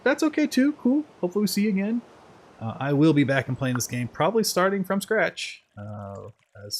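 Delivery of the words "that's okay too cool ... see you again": cheery sign-off. Real, not acted. Sign-off to chat.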